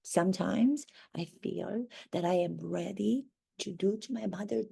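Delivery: normal talking voice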